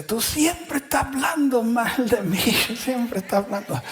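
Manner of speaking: leve voz de amigo quejoso, más aguda; imitando al amigo. Retold or imitated. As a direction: imita al amigo que reclama, en broma. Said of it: "me dice". (el amigo se queja de que hablo mal de él)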